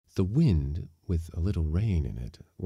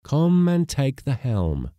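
loud, drawn-out, high command; crying out an order; 8 semitones higher, 7 dB louder, slower than usual. A narrator's reading. Skipper ordering the mate to the helm, loud cry. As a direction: bellow it slowly across the deck. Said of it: "he cried".

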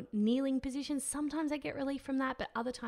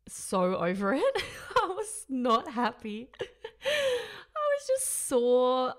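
slow, laughing, high on the last words; laughing through it; 3 semitones higher, 5 dB louder, much slower than usual. Real, not acted. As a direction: laugh while admitting you were miserable. Laughing while recalling misery.

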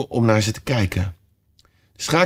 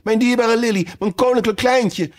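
much higher, frantic mother-queen voice; doting queen; 12 semitones higher than usual. A narrator's reading. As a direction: gush over your baby in a high fluttering queen voice. Queen rushing to her child.

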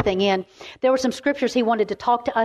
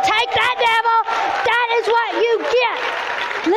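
triumphant taunt; 12 semitones higher, slower than usual. Retold or imitated. Woman taunting the devil after the others step in, triumphant. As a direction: crow over a beaten foe, drawing out the last words.

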